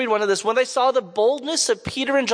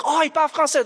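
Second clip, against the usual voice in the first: pitched up high with glad surprise; happy to hear French from him; retelling their happy surprise; delighted surprise; 7 semitones higher, faster than usual. Retold or imitated. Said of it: "And they were like".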